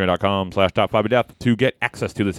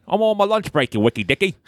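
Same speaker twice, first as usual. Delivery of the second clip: mock Australian accent; 11 semitones higher than usual. Continues the Aussie impression.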